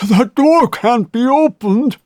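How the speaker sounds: put-on high, loud strained groan of an old man; groaning old banker. A narrator's reading. Banker groaning that the vault door won't open. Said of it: "he groaned".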